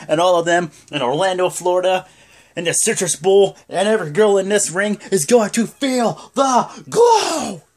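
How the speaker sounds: loud, high promo building to a stretched-out last word; wrestler's comeback promo. Retold or imitated. Wrestler announcing her WrestleMania return, big hyped-up promo. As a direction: imitate a champion hyping the crowd, slowing into the catchphrase. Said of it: "I can't do it 'cause I'm not a girl".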